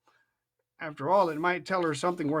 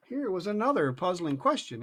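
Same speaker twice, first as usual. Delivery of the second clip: ordinary delivery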